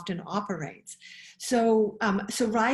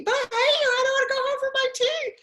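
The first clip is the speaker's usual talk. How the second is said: put-on high, loud whining little girl; whiny child; 6 dB louder, much faster than usual. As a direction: whine it like a cross five-year-old. Child voice again.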